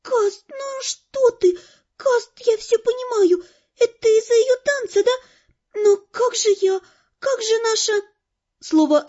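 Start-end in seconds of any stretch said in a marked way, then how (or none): 0.0-7.9 put-on high, tearful pleading girl; unhappy angel. A narrator's reading. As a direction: plead pitifully, high and plaintive. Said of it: "превратилась из разгневанной фурии в несчастного ангела". (несчастно, жалобно, высоким голосом)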